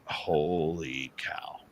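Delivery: very slow, drawn-out wonder; stunned amazement. Retold or imitated. Dave recognising the samurai chatter, slow stunned reaction. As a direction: stretch out HOLY in disbelief.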